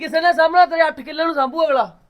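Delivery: very high, loud enraged yell; furious shouting. Acted. Brother yelling at sister who threatens to leave, enraged. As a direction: scream her plan down.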